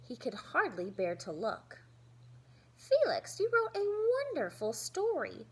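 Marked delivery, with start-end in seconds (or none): 2.8-5.5 high, bright praising teacher voice, louder. Acted. Teacher voice praising Felix's story to the class. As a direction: lift up high to praise him.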